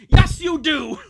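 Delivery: very loud, high parent's answer, YES hit hardest; forceful retake. Acted. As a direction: bellow YES like a final word. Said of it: "Oh, wait, no, sorry".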